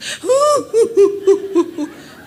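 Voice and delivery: high, loud sobbing wail; loud wailing laugh-cry. Retold or imitated. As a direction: let out a big wail. Preacher imitates woman's wail. (tangisan keras meraung)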